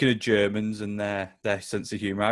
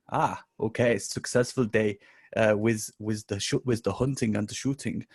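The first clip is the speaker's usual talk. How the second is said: joke-teller's mock German accent; lightly hammed German accent. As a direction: play the German hunter with th turned to z. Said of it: "so, the German guy".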